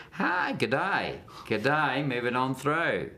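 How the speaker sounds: mock Australian accent, slowing at the end. Shown as a demonstration. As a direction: demonstrate a breezy Australian greeting accent. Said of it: "and get".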